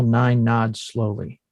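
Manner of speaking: ordinary talk, nothing marked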